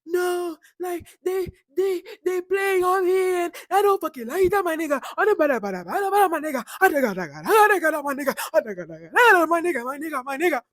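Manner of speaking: high, loud mock wail of protest; hammed-up high upset ranting; imitating Biggie upset. Mimicking Biggie's upset protest to the room, loud high wail. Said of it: "And Biggie's like".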